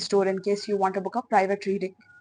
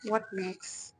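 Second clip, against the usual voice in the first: hushed channeling voice. Acted. Reader asking spirit about pile three, hushed and mystical.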